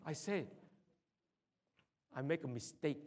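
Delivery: ordinary talk, nothing marked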